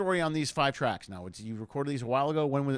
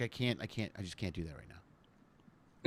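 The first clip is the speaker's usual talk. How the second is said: weary mumble; 9 semitones lower, 10 dB quieter, slower than usual. Retold or imitated. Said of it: "you're like".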